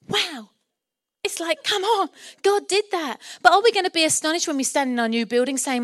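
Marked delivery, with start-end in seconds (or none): 0.1-0.5 higher-pitched wow of amazement; astonished. Shown as a demonstration. Preacher shows the church what astonishment is, astonished. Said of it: "astonished is like". 1.6-3.2 high, amazed exclamation; astonished. Shown as a demonstration. Preacher demonstrates reacting to answered prayer, astonished. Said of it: "astonished is like".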